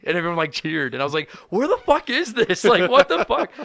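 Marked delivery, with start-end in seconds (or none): 1.5-3.5 high-pitched, slowed-down disbelief; baffled comic crying out, voice climbing; bewildered outcry. Retold or imitated. Comic to cheering Grand Prairie crowd, bewildered outcry.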